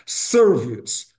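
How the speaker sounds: SERVICE in two syllables, SER-vis, silent final E; first-syllable stress. Shown as a demonstration. Teacher models American pronunciation, SERVICE stressed on first syllable. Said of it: "é na primeira sílaba".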